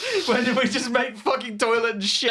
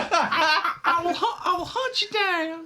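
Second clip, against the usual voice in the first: high, laughing mock threat; hammed-up villain threat; 10 semitones higher, slower than usual. Playful threat while laughing.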